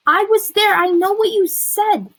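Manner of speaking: loud, high, furious retort; angry outburst. A narrator's reading. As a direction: throw the proof in her face. Quarrel at its peak.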